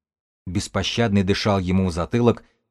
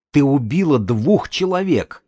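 higher, slow, indignant exclamation; outraged accusation; 6 semitones higher than usual. A narrator's reading. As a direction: stretch the accusation out in rising outrage. Acted accusation in a quarrel.

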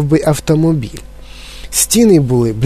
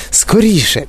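higher-pitched, mildly urgent hurry-up; urging on; 6 semitones higher than usual. A narrator's reading. Wonka urges dawdling visitors along, mildly urgent call. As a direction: hurry them along briskly. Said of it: "підганяв містер Вонка".

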